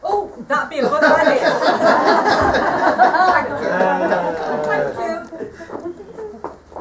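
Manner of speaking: giggling while saying thank you; real laughter breaking the words; laughing. Real, not acted. Laughing at the cigarette offer.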